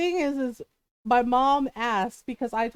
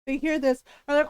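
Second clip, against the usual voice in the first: normal talking voice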